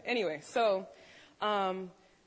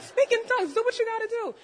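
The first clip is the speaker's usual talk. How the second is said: high, loud frantic command with laughter; loud, high-pitched comic panic; freaking out; 13 semitones higher, 8 dB louder than usual. Retold or imitated. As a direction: re-enact panicked orders, half laughing. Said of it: "I'm freaking out".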